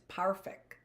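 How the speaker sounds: put-on a light German accent on perfect, said as pharfect; lightly mimicking his German accent on perfect. Orphan mimics Mr Schatzman's word, in a light German accent. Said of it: "in his German accent".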